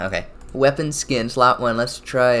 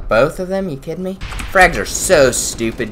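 ordinary talk, nothing marked

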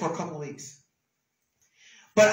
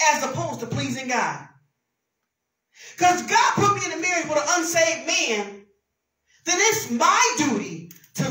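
full-force preaching; 6 semitones higher, 9 dB louder than usual. Acted. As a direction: drive every word home from the pulpit.

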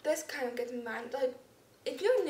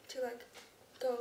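usual speaking voice

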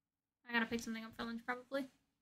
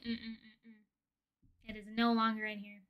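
ordinary delivery

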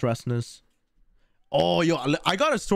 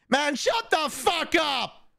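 mock angry shout; 14 semitones higher, much slower than usual. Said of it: "Nah, I'm playing".

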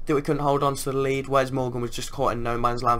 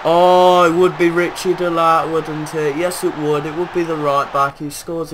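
loud, high exasperated outburst; sarcastic angry shout; bitter groan; 4 semitones higher, 9 dB louder than usual. Real, not acted. Conceding to former player.

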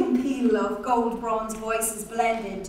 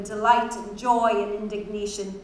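plain reading voice

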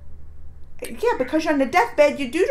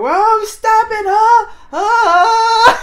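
high, slow, crying wail of a grieving king; mock wailing blind king; 11 semitones higher, 13 dB louder, much slower than usual. Imitating the blind king wailing at the deathbed. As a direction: wail it out like the old king begging. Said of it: "You know how he's doing".

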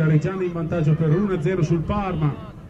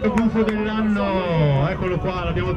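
grand announcer; 5 semitones higher than usual. Acted. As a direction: announce it like a gala host.

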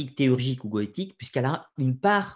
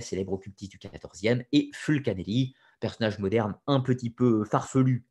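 ordinary talk, nothing marked

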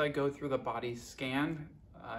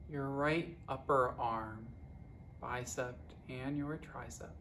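guided meditation; 7 dB quieter than usual. Acted. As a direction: guide them unhurriedly, letting each phrase settle. Leads a guided body scan.

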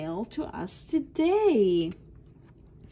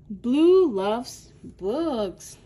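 put-on loud, slow and bright for kids; cheerful show-host line; enthusiastic announcement; 8 dB louder, much slower than usual. Shown as a demonstration. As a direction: announce it with delight to little ones. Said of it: "slow, loud announcement for children".

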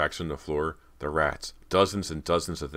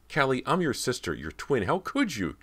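high, desperate pleading; panicked, pitched-up outcry; frightened shriek; 10 semitones higher than usual. A narrator's reading. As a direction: shriek it in disbelief at a sister's betrayal. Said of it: "Cody shrieked".

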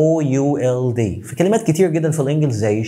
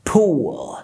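pool whispered, breath only; whispered demo. Shown as a demonstration. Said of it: "كلمة".

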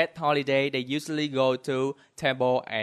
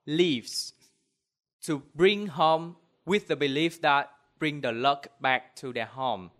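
ordinary delivery

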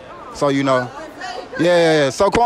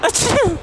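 sneezing. Pretend. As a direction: sneeze it out big and high. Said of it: "who got the best sneeze".

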